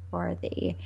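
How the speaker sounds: ordinary delivery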